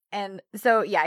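ordinary talk

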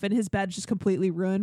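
ordinary talk, nothing marked

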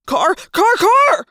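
escalating high, loud shouts; panicked shouting that builds; yelled warning. A narrator's reading. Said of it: "Jake yelled".

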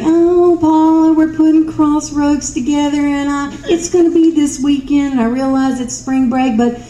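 high whining plea, mock helpless; whiny. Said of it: "She didn't whine about it at all".

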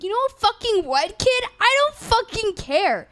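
a fed-up retold snap at a pushy kid; half-playful irritated retort; mock snapping at a kid.

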